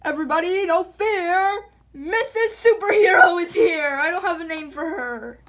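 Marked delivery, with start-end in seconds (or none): 0.0-4.0 louder and higher, proud entrance; superhero announcement. Pretend. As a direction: announce the heroine to the crowd. Superhero making grand entrance.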